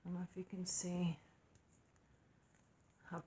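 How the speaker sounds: soft breathy whisper; whispered aside. Real, not acted. Mom showing stickers to camera, whispering aside. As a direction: drop to a whisper mid-sentence.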